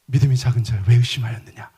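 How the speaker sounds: Jesus gently encouraging Peter; gentle encouragement. Shown as a demonstration. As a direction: say it like a kind pat on the back. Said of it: "이렇게 하시겠어요?".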